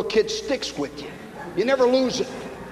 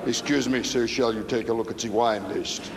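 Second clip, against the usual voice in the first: waiter; 9 semitones lower, 6 dB quieter than usual. Retold or imitated. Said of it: "comes up and says".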